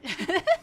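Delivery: sudden loud high laugh; burst of laughter. Real, not acted. Laughs at chat's sober claim.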